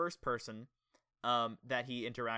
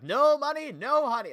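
imitating CJ's taunt, much higher and louder; mocking CJ; 11 semitones higher, 11 dB louder than usual. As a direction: belt it out like a smug kid mocking. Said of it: "did you just hear the way he was talking?".

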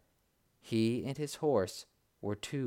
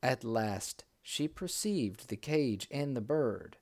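ordinary delivery, nothing marked

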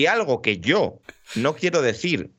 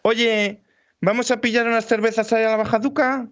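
high-pitched mock Santander accent; 8 semitones higher than usual. Shown as a demonstration. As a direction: imitate a Santander local, pitched up. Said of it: "es un poco este rollo".

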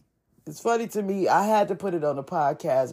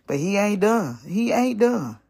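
ordinary delivery

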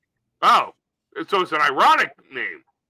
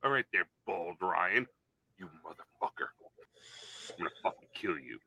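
low gruff cowboy threat; 13 semitones lower, 14 dB quieter than usual. Acted. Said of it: "It's like".

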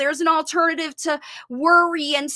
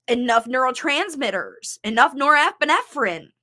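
ordinary talk

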